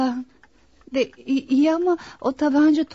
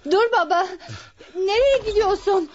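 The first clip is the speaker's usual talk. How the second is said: urgent, raised-pitch plea to stop; alarmed call; 4 semitones higher than usual. Acted. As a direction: call after your father in alarm. Daughter tries to stop her father.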